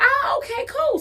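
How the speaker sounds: mock cheerful, high and bright; high sarcastic calm. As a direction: act unbothered with a sweet high lilt. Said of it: "So I was like".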